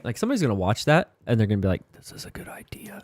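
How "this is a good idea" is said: hushed mock scheming; very soft, whispered thinking-to-oneself; conspiratorial whisper. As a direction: whisper it like a secret plan. Imagined viewer plotting to try it.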